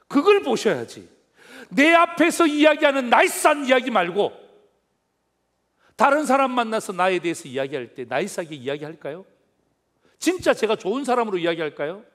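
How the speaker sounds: loud, high preaching challenge; fervent preaching. Real, not acted. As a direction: drive the point home to the congregation. Preaching at full force. (힘 있게 외치는 설교)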